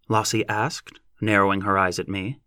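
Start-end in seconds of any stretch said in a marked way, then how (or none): none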